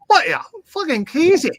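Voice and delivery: lightly voiced boss, laughing in disbelief; mock boss, high and laughing; Paul voice. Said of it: "you have a, a Welsh accent".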